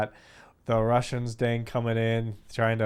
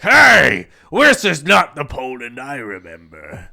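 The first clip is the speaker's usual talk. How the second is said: put-on grumpy old person; 3 semitones higher, 14 dB louder, slower than usual. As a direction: yell it like an outraged old man. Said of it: "the old people will be like".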